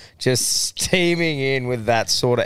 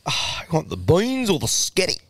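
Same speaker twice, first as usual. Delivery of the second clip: host lightly imitating the old bloke, a little higher; mock country bloke; 5 semitones higher than usual. Host parrots the old bloke's beans offer in mock voice. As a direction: echo the punchline in a put-on bush voice.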